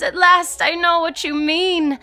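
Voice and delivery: high-pitched gush of revelation; rapturous. A narrator's reading. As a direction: gush with relief, voice way up. Lydia feigns sudden rapture.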